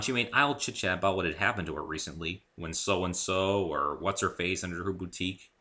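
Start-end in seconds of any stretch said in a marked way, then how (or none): none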